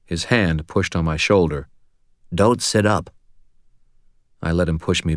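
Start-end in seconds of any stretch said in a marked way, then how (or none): 2.3-3.1 blocked nose. A narrator's reading. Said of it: "His voice was thick and slurred".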